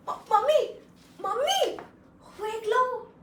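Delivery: high, rising pleading call; pleading child. Acted. Child begging mother for a samosa, high and pleading. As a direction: beg mom sweetly for one samosa.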